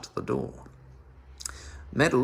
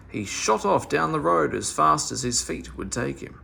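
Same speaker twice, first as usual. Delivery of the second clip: ordinary delivery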